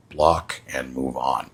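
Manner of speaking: usual speaking voice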